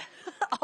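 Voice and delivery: high unvoiced giggle; burst of laughter. Real, not acted. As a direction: break into a laugh mid-sentence. Laughing at own slip.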